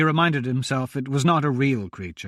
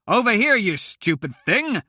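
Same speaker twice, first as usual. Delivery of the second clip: shout; 7 semitones higher than usual. A narrator's reading. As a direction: yell to pull the beast away. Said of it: "he shouted".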